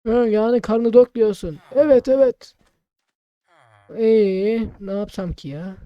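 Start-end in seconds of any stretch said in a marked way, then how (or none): 1.7-2.5 put-on high loud old man voice; old shopkeeper voice. Player voicing shopkeeper Ahmet, high and loud. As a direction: answer like a hearty old uncle.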